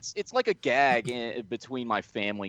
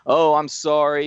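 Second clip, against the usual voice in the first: mild mock apology, raised and louder; retold apology; 6 semitones higher, 5 dB louder than usual. Wrestler apologizing to fans at the signing, mock apologetic. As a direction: imitate a wrestler saying sorry to fans. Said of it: "He was apologizing to everybody".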